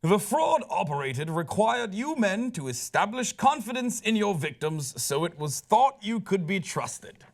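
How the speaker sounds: reading the judge's words in a put-on British accent; a judge's formal British accent, slowing right down on the last words. Host reads the judge's sentencing remarks in a mock British accent. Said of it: "I'm going to read this in my British accent".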